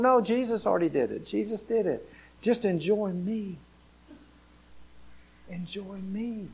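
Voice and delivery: calm them and invite them close; gentle reassurance. Acted. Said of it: "He's going".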